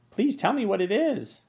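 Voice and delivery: high, eager pleading. Acted. Wilbur begs Charlotte to reveal her secret, pleading. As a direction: beg like an excited little pig. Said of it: "begged Wilbur".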